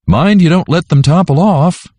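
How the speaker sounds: high hollered warning across the wagon; father hollering to his son, pitched high. A narrator's reading. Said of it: "Daddy hollered".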